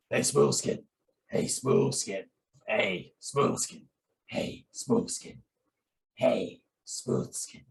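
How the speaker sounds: rough, rasping ghoul voice, drawn out slowly; practicing a slow, airy, rough-throated ghoul; Fallout ghoul. Shown as a demonstration. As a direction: rasp it like a burnt-throated ghoul. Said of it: "an attempt to mimic the ghouls".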